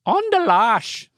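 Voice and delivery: high Irish echo; playful, high Irish lilt; mock Irish accent. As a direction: repeat the slang in a hammed-up Irish accent. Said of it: "You gotta use an Irish accent".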